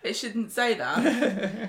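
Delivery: slow protest through giggles; laughing. Real, not acted. Scolding the robot's word, giggling. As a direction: laugh while trying to scold.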